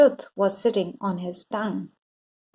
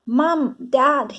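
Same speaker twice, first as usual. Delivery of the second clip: high, loud call; boy calling his parents; crying out; 4 semitones higher, 4 dB louder than usual. A narrator's reading. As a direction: call downstairs urgently. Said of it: "he cried".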